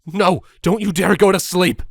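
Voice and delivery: desperate shout. Acted. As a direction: shout to keep her awake. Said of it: "I shouted desperately".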